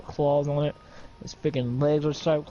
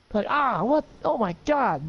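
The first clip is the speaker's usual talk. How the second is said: much higher startled cry of pain; shocked cry; 9 semitones higher than usual. Acted. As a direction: gasp at the lost hand. Hand sliced off, shocked.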